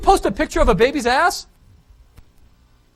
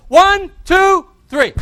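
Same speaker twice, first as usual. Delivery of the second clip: higher, louder count for the crowd; 8 semitones higher, 8 dB louder, much slower than usual. Real, not acted. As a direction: call out the count to the room.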